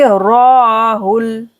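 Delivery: रॉहुल with a long held, rounded raa; slow रॉहुल, first vowel lengthened; stretched raa. Shown as a demonstration. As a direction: draw out रॉ, then short hul. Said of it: "रॉ को खींचेंगे".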